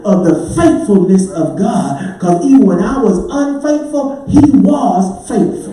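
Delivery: whooping preacher. Acted. Preacher whooping to the congregation about God's faithfulness. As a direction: lift the congregation into the song.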